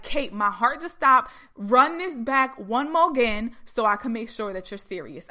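loud, emphatic disbelief; her own stunned reaction. Retold or imitated. Woman re-enacting her call with Kate, loudly incredulous. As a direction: punch it out, thrilled and incredulous. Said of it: "I literally said it like that".

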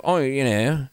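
hammed-up British TV accent; mock British accent. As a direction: imitate a British fantasy actor, drawn out. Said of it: "they're all, like, talking like".

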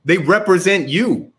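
heated rant. Real, not acted. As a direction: push it up, fired-up argument. Heated rant about senators.

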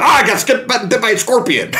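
high-pitched panicked cry; hammed-up alarm, voice way up; mock panic. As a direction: yelp it as if just stung. Riff voicing the screaming villain.

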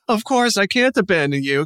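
high, earnest mock devotion; devoted wife. Wife promising the corpse she'll stay, high and earnest. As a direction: swear it like a lovestruck heroine. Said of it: "she's like".

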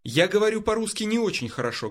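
usual speaking voice

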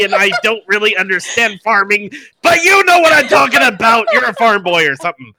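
high, rousing mock sea-shanty pep talk; hammed-up pirate. Bard trying to inspire a farm boy, hammed-up pirate. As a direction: belt out a silly rally, loudest near the end.

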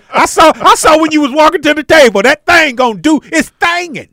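loud, high, hyped-up catcall; loud giddy catcall; excited teasing. Acted. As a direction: hype it up like you can't believe what you're seeing. Teasing about a woman's body.

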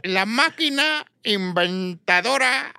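high, slow evil inventor; mad scientist. Pretend. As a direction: cackle it like a mad doctor. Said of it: "laboratorios del doctor Chunga".

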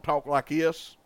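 lightly put-on Southern twang. Shown as a demonstration. Co-host showing how locals talk, in a Southern twang. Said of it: "some twang in there".

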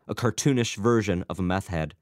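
plain reading voice

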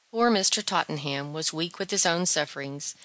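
usual speaking voice

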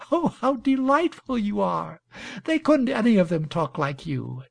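put-on high-pitched admiring young woman; delighted Imogene. A narrator's reading. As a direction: start high with delight, then settle.

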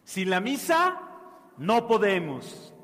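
Sin la misa no podemos with misa hit loud and high; emphatic declaration. Acted. Preacher drives the martyrs' motto home to the children, stressing misa. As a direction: punch misa, then a slow firm no podemos.

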